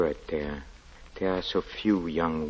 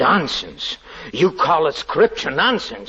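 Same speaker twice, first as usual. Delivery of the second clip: sharply rising, louder, outraged at the insult; indignant outburst; 11 semitones higher, 7 dB louder than usual. Acted. Lodger to the girl who mocked scripture, indignant outburst. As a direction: fling the word back at her in fury. Lodger rebukes Daisy's words.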